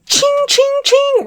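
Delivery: very high, loud sword ching; katana clang. Retold or imitated. Sword fight with the woman, loud high clangs. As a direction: ring out like clashing blades. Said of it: "I'm like".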